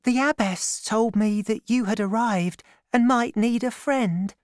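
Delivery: put-on high, gentle girlish voice; young woman. A narrator's reading. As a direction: play Constance, shy and kind. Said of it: "said the young woman".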